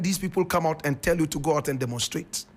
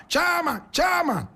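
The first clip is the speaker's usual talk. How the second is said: mock followers' call; 10 semitones higher than usual. Mimicking followers calling chairman.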